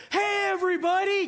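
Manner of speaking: mock perky announcer shout; pumped-up host. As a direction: imitate a lady hyping up a crowd. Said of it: "Kinda gets them pumped up".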